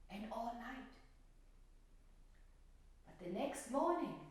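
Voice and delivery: put-on gentle storytelling to children; story narration. A narrator's reading. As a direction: read it like a fairy tale for little ones. Teacher tells the violet story.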